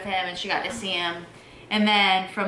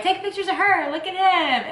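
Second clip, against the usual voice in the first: cheerful nurse; 10 semitones higher than usual. Retold or imitated. Nurses voiced in story.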